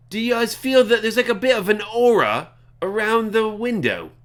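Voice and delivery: high-pitched character voice; Gale. Acted. Game character asking the party about an aura, high role voice. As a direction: lift your voice well above your own for the role. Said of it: "Gale also says".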